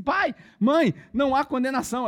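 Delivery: high, loud call to the parents; excited proclamation. Retold or imitated. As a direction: imitate a daughter bursting out with big news. Daughter announcing news to parents.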